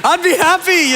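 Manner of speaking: lightly voiced craving, laughing; a hint of a dreamy junk food fan; mock donut lover. Pastor imitating a donut lover for the congregation, laughing lightly. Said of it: "people that were like".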